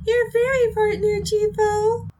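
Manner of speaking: very high-pitched fairy partner voice; fairy mascot. Pretend. Podcast host introducing themself as the fairy partner, high mascot voice. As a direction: put on a high little mascot voice.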